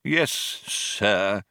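put-on restrained, cold old man. A narrator's reading. Old man yields to Benji, coldly. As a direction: answer coldly, held in. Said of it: "said the old man coldly".

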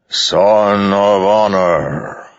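vast, slow, booming storm voice; voice like roaring wind. A narrator's reading. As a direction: speak as the storm itself, huge and drawn out. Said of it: "said a voice like roaring wind".

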